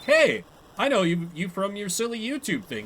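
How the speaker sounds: excited fan greeting. Pretend. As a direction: greet the comedian like an old friend. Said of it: "be like".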